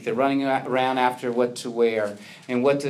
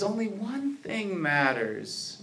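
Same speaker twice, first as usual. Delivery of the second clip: slow and softer, calmly setting her straight; gentle quote; 5 semitones higher, 6 dB quieter, slower than usual. Retold or imitated. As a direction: let the words settle, quiet and slow. Yeshua's answer, gentle quote.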